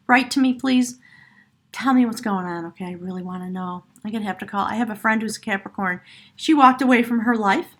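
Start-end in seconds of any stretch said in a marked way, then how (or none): none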